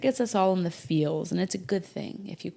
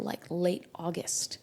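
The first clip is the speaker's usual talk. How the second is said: ordinary talk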